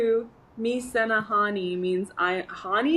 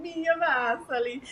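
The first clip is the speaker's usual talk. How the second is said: Italian accent; 5 semitones higher, 3 dB quieter than usual. Shown as a demonstration. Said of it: "I don't want it to sound Italian".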